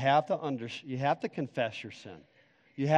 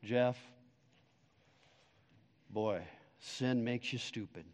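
softer, unhurried Southern pastor; quoting a mentor, calm Southern and softer; calm Southern drawl; 5 semitones lower, 9 dB quieter than usual. Retold or imitated. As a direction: lay it down easy like an old Southern preacher. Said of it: "real calm".

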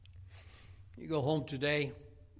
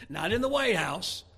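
rising louder and higher, list by list; preacher escalating to the congregation; preaching building up; 7 semitones higher, 7 dB louder than usual. Acted.

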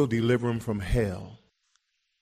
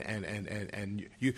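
usual speaking voice

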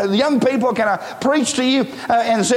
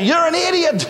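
yelling boss; 8 semitones higher than usual. Retold or imitated. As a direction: play the furious boss berating an employee. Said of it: "to that boss said".

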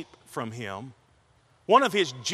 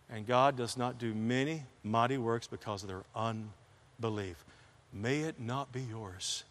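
solemn hush; 5 semitones lower, 8 dB quieter than usual. Acted. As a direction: drop it to a grave near-hush. Solemn closing warning of sermon.

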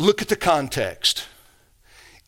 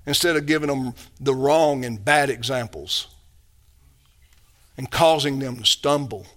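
usual speaking voice

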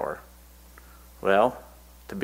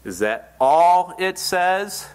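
challenging preacher; 8 semitones higher, 4 dB louder, much slower than usual. Retold or imitated. Evangelist challenges young man.

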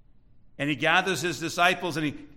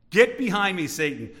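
quicker command with a forceful GET; brisk rebuke; 3 dB louder than usual. Acted. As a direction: hit GET hard, then drop on SATAN. Same rebuke, said faster.

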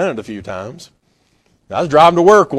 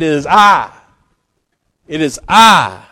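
solemn declaration; 6 dB louder than usual. Acted. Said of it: "No, he said".

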